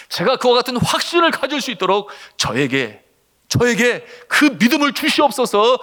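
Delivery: building from subdued to high, impassioned plea; re-enacted fervent prayer; pleading prayer. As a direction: cry out to God, rising in urgency. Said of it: "그런 기도를 드리지 않을 수가 없었어요".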